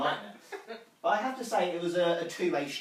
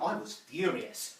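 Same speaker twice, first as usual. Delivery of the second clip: normal talking voice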